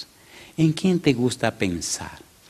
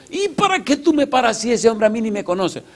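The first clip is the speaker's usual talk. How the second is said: high, strained voice begging to be let go; a cornered man whining to get past; bandit pleading; 11 semitones higher than usual. Retold or imitated. Bandit begs the pastor to move, high and strained.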